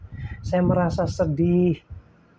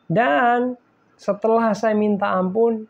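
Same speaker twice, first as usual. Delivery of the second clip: usual speaking voice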